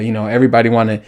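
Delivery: fast tumbling words; words running ahead of him; rushed, tripping over himself; rushing. Real, not acted. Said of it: "let me, let me slow down".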